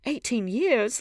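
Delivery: high, loud triumphant exclamation. A narrator's reading. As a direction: throw it back in triumph, pitched high. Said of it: "laughed Dorian Gray with a touch of triumph".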